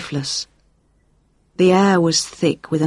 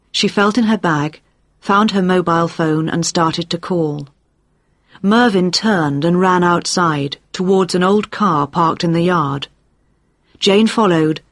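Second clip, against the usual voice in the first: usual speaking voice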